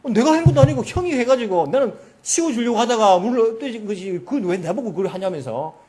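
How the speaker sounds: heated mock sibling row; angry quarrelling. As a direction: act out the youngest brother throwing back the blame. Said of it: "막 서로 막 싸우게 된 거예요".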